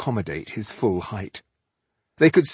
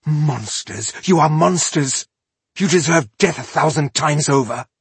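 raised, louder accusation; hissed fury; 6 semitones higher, 5 dB louder than usual. A narrator's reading. Rebel accusing the elf of killing kin, furious. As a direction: spit out the condemnation. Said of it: "she hissed".

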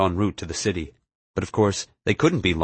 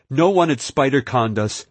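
higher-pitched insistent denial; defensive, raised-pitch protest; warning; 6 semitones higher, 4 dB louder than usual. A narrator's reading. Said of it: "Murray warned".

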